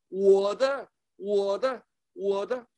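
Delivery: put-on loud insistent child claiming 我的; greedy toddler's "mine". Preacher mimics a toddler at the congregation, loud and grabby. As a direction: shout it like a grabby little kid.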